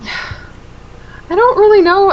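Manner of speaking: sighing. Real, not acted. Sighing before MVP pick.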